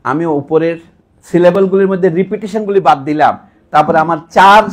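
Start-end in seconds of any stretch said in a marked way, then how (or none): none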